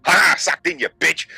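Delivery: high, crowing taunt; gleeful mock gloating. Kid gloating over teachers, gleeful jeer. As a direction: crow it like a point scored.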